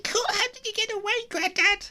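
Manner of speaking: very high excited question; eager little kitten. Acted. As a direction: hang on every word. Ginger eager at Grandad's story.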